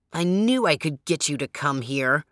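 smug villain savoring his trick; sneering porcupine. A narrator's reading. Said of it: "sneered the porcupine".

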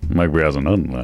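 low, mumbled sportscaster catchphrase; Ross Porter. Acted. Drunk play-by-play man repeating his call, slurred impression. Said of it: "a Ross Porter impression".

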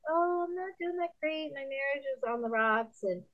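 high, plaintive imitation of a struggling wife; troubled churchgoer. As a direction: mimic a discouraged woman confiding. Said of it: "And she's like".

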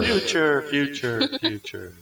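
fading echoed repeats; echo effect. Shown as a demonstration. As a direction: let the word echo away. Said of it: "so we can do something like today".

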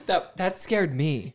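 ordinary talk

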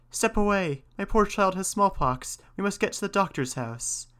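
high-pitched warning to passers-by; urgent cry. A narrator's reading. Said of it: "and his wife cried".

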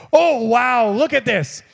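high-pitched wonder; preacher acting out delighted discovery; amazed excitement. Acted joy at new life.

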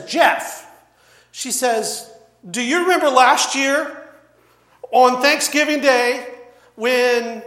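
mock loud reminder to a relative; sister-in-law's confrontation. Acts out sister-in-law confronting Jeff.

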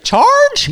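high, loud, unsure "Charge?"; battle cry as question. Acted. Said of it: "like it's a question".

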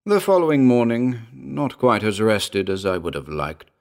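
usual speaking voice

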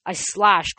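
plain reading voice

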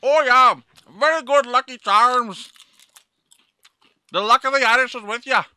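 high, loud hammed-up Irish; mock Irish accent. Man praises the Irish chips in loud mock Irish. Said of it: "That was like a fucking Wisconsin accent".

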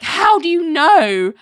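high, loud mock protest; imitating an indignant comeback; indignant challenge. Jamie challenges Larry in acted quarrel.

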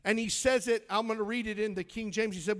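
ordinary talk, nothing marked

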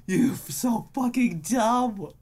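high, slow fed-up jab; exasperated insult. Retold or imitated. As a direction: drag out the insult. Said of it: "I was like".

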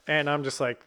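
usual speaking voice

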